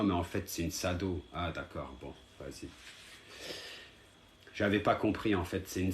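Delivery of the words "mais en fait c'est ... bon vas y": low, soft, dropping to a mumble; deflated. Real, not acted. Streamer realising the viewer enjoys his anger, deflated. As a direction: let the fight drain out, sinking low. Taken aback, drops the fight.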